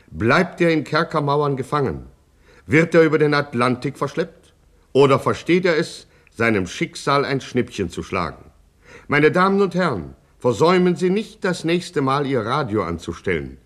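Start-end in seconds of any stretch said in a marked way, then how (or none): none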